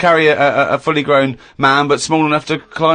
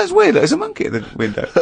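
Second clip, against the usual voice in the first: high, quick puzzled squeak; mock bemused onlooker; 9 semitones higher, 4 dB quieter, faster than usual. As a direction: rattle it off high, mimicking bemusement. Acting out people in burning building.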